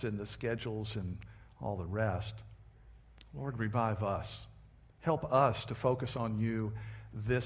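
soft, earnest prayer voice. Acted. Pastor praying over the congregation, soft and earnest. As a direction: pray it quietly and humbly. Pastor prays before sermon.

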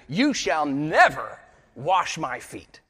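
loud, slow, forceful refusal; emphatic blurt. Retold or imitated. Peter refusing to let Jesus wash his feet, loud and emphatic. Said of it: "he blurts out emphatically".

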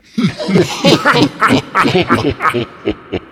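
loud, put-on chuckling of trees; trees' laughter. A narrator's reading. Trees all react to the boy, loud acted laughter. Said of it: "the trees all went".

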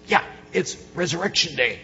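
normal talking voice

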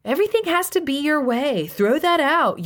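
high, whiny parody of his wife; mocking imitation. Husband mocking his wife's nagging, high whiny parody. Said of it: "he mocked".

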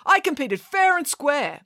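high, slow, aggrieved insistence; drawn-out indignant protest. A narrator's reading. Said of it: "protested Jason".

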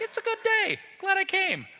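high, breezy put-on voice for Peter; mock casual Peter. Preacher mocks Peter's glib words on the mountain, in a high breezy voice. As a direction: toss it off like a cheerful tourist.

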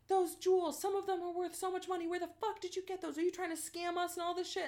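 high, fast, aggressive thug voice; screaming gunmen. Retold or imitated. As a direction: bark the accusations up high. Gunmen screaming outside the door.

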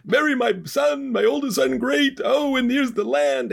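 very high, giddy delight; hammed-up squeal of happy agreement; ecstatic father. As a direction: gush like an overjoyed father. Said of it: "Father's ecstatic".

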